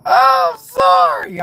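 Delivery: very high, slow, stretched plea; pleading. Retold or imitated. His inner thought apologising to his body, high and slow. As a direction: draw out a tiny desperate sorry. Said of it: "the thought said".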